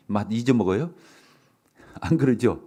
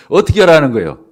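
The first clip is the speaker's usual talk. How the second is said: laughing louder question; 3 semitones higher, 11 dB louder than usual. Shown as a demonstration.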